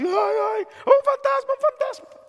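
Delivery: very high, hammed-up panicked shriek; mock terrified screaming. Shown as a demonstration. Preacher showing how the frightened disciples screamed, mock terrified screaming. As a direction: squeal it in exaggerated comic terror. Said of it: "no sé cómo estaban gritando".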